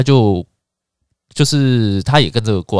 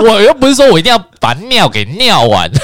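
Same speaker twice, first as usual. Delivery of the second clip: laughing defiance; 11 semitones higher, 6 dB louder than usual. Real, not acted.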